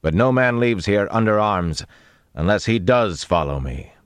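booming. A narrator's reading. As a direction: address hidden men so every word carries. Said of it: "they boomed against the wall".

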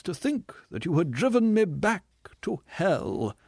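high, slow, theatrical self-pitying voice; a wounded ham actor milking every line; tragedian. Acted. Tragedian's bitter speech.

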